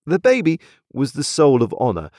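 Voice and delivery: ordinary delivery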